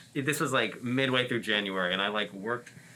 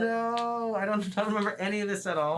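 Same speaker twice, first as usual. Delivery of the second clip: panicked; 10 semitones higher than usual. Retold or imitated. Panicked retold reaction.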